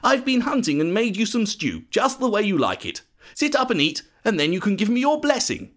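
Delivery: high, lilting voice of an impostor son; lightly acted eager deceiver; younger son pretending. A narrator's reading. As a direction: coax your father, pitched well up. Jacob poses as Esau to father.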